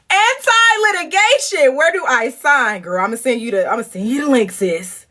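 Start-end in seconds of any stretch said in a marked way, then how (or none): 0.1-2.8 high eager quip; a hint of a squeaky eager friend; lightly voiced friend. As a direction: chirp it up like a friend signing on. Quoting Kimmy, high.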